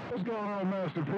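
high, bright-pitched hail to a buddy; excited CB shout-out, pitch jumping up; excited greeting. Real, not acted. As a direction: call out to your homeboy, thrilled to hear him. CB greeting to arriving friend.

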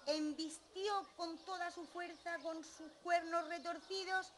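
high, drawn-out climactic narration; forceful charge. A narrator's reading. Big goat charges with all strength.

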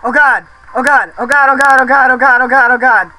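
frantic cries. Real, not acted. Frantic during battle.

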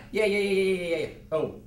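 ordinary talk

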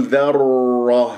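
ذرة drawn out toward dharroh; rounded ra, wrong. Shown as a demonstration. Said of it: "هكذا تكور الشفاه". (ذرة بتكوير الشفاه)

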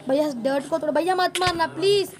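loud, insistent plea; real loud begging; urgent pleading. Real, not acted. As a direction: plead loudly with big brother, rising on the last word. Begging brother not to hit.

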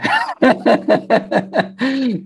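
a quick real laugh, breath out; short laugh. Real, not acted. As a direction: break into a brief genuine laugh. Laughs at his own example.

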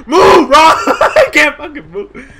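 frightened loud yelling; panicked shouting. Real, not acted. Monster appears, panicked shouting.

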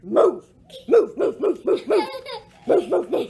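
loud, rapid barking moves; barked. Acted. Dog barking orders at the cat, loud and laughing. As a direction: bark each move at the cat. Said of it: "He barked".

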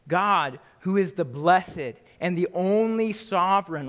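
preacher reading First Timothy aloud; scripture reading. Acted. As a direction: proclaim the verse with weight. Reads scripture with emphasis.

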